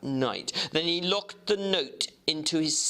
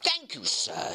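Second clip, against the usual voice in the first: clerk Guest; 13 semitones higher than usual. Acted. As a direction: draw out the first words, keen to look. Guest returns the papers.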